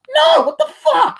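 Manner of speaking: very high, loud and slow shriek; shrieking disbelief. Acted. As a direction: stretch it out as a shocked shriek.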